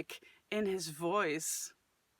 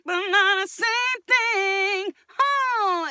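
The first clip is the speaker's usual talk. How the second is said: frustrated cry; 11 semitones higher, 7 dB louder, slower than usual. Shown as a demonstration. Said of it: "what he's using in his voice".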